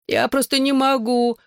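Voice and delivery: moaning. A narrator's reading. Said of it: "застонала Клэр".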